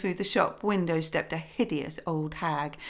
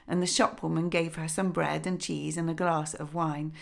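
usual speaking voice

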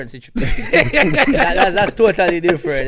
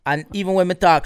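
ordinary talk, nothing marked

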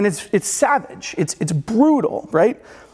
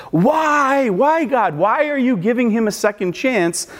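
exasperated onlooker; 6 semitones higher than usual. Pretend. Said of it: "you're like".

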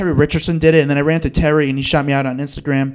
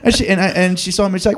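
usual speaking voice

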